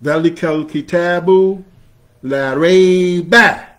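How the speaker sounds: تلاوة قرآنية; Quranic recitation. Shown as a demonstration. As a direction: recite it in tajweed. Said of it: "where Allah reveals".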